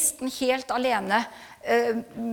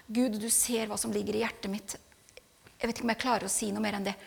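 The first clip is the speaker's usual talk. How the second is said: softer, intimate prayer to God; hushed prayer; 4 semitones lower, 9 dB quieter, faster than usual. Retold or imitated. Wife voicing her silent prayer to God, softly. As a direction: murmur it like a private prayer.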